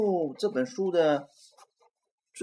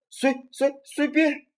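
much higher, stuttering frightened voice; scared stammer; 12 semitones higher, 4 dB louder than usual. Acted. Frightened Robert answers the monster, scared stammering. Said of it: "罗伯吓坏了".

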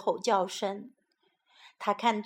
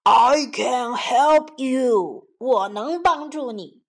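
slow, loud, big elephant voice; 5 dB louder, slower than usual. A narrator's reading. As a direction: boom it out like an elephant. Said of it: "并大声地说".

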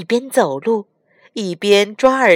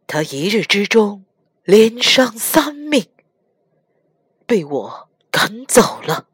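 sighing regret. Acted. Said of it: "唐僧叹了一口气".

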